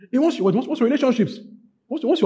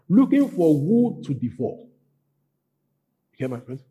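ordinary talk

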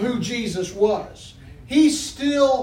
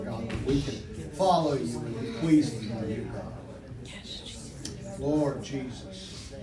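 hushed prayer; 7 semitones lower, 7 dB quieter than usual. Acted. Pastor praying aloud to Jesus in church, soft and low. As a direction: murmur praises of hallelujah, lower and softer.

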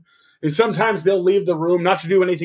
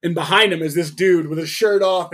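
usual speaking voice

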